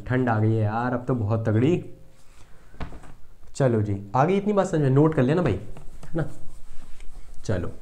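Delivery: normal talking voice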